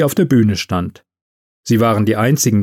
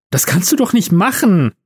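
higher-pitched appalled protest; horrified; 6 semitones higher than usual. A narrator's reading. Elf grabbing Santa's wrist to stop him, horrified. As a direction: plead with him in dismay. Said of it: "redete Liv entsetzt auf ihn ein".

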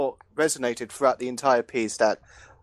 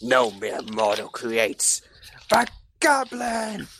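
Green Goblin; 3 semitones lower, much slower than usual. Acted. Villain answering his creator's plea, slow grand proclamation. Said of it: "and the Green Goblin is like".

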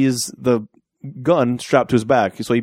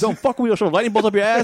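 much higher hammed-up threat; mock god threat; 9 semitones higher, faster than usual. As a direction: play an angry Zeus, overblown.

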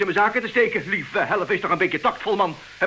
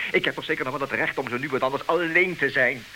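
ordinary delivery

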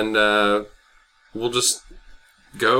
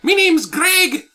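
high leprechaun voice; 10 semitones higher, slower than usual. Acted. Leprechaun introduces himself.

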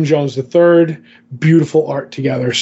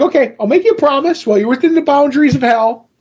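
higher, quick retelling of Lucifer's offer; retold promise; 9 semitones higher, faster than usual. Lucifer promising Dream safety in hell, higher and quick. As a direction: lightly voice a hurried, high-pitched promise.

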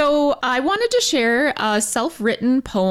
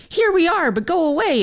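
crow voice; 8 semitones higher than usual. Retold or imitated. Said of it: "is what we humans feel they say".

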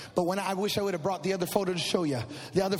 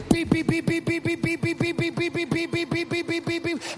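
higher, rapid beeping; mock machine beeps; 6 semitones higher than usual. Father imitating the respirator beeping in the room.

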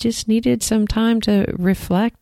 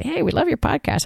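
cheery listener; 10 semitones higher, 4 dB quieter than usual. Retold or imitated. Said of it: "we would love you to get on there and say".

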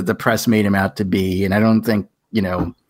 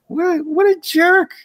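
much higher, slow incredulous exclaim; indignant disbelief; slower than usual. Retold or imitated. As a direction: sound appalled at her rudeness. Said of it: "And I was like".

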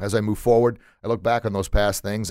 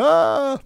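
high, loud disgusted ah; a kid's loud grossed-out squeal; kids' disgusted cry; 6 dB louder than usual. Retold or imitated. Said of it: "and the kids go".

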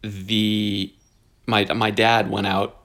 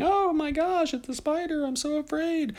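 mock scared shriek; 4 dB quieter than usual. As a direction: fake a frightened squeal up high, but keep it soft.

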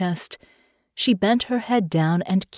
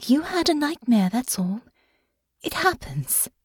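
light, soothing tone; reassuring; 7 semitones higher than usual. A narrator's reading. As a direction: gently brush away a bad dream. Comforting partner after a nightmare.

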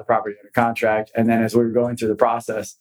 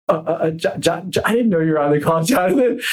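higher, flustered stammering, breaking into a nervous laugh; fumbling stammer; 6 semitones higher than usual. Retold or imitated. Attorney caught out by the managing director, stammering. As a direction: caught out and scrambling for words. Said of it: "the guy started fumbling".

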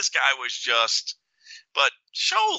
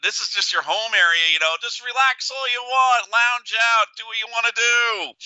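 sarcastic jab; 8 semitones higher than usual. Retold or imitated. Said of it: "I'm saying it just as loud as I am right now".

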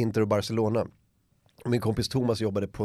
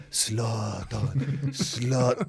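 a hint of hushed awe, softer and rising; awed worship; 3 semitones lower, 5 dB quieter, much slower than usual. Kids touching their idol, lightly voiced hushed awe. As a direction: murmur the name like a worshipper before a god. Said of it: "primitiva människor möter sin gud-vibb".

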